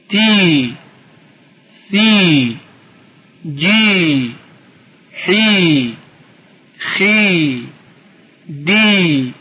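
each syllable's "ی" held as a long, sustained ee; drawn-out long vowel. Shown as a demonstration. Teacher reading letters for pupils to repeat, drawn-out ee. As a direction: stretch every "ی" evenly, one syllable at a time.

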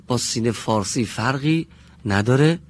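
ordinary talk, nothing marked

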